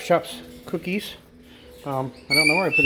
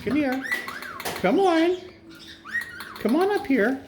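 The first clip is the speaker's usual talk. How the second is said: high coaxing call. Real, not acted. Owner calling her parrots up to the perch, very high coaxing. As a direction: coax in a very high sing-song call.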